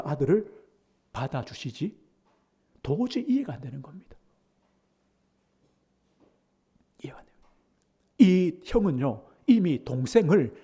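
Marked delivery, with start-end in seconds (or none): 2.8-7.3 soft unvoiced whisper; quiet bewildered whisper; hushed whisper. Real, not acted. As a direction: breathe it out in disbelief. Whispered incomprehension.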